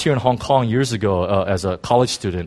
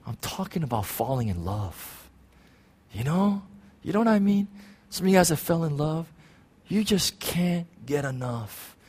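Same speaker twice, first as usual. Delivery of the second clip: tender hush; 6 semitones higher, 7 dB quieter than usual. Real, not acted. Soft turn to falling in love.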